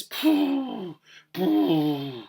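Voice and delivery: high, hooting mock whale noise; comic whale call, starting very high and dropping. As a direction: blow out a pooh like a whale. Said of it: "he'd start making whale noises".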